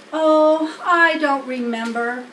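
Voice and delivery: a lightly voiced humble pig, sheepish; Wilbur voiced. A narrator's reading. Pig admits to the spider he showed off, lightly voiced. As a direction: admit it meekly, a hint of a young pig.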